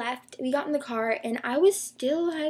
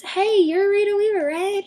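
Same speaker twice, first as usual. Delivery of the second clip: high, louder starstruck hail; re-enacted eager greeting; 9 semitones higher, 8 dB louder than usual. Fan walking up to a gymnast's mom, eager greeting. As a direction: call out to her, pitched up with excitement. Excitedly approaching a celebrity's mom.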